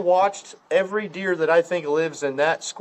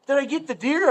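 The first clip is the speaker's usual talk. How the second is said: higher-pitched retold question; mock eager hunter; 9 semitones higher than usual. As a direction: imitate a wounded but excited shooter. Voicing bleeding shooter's eager question.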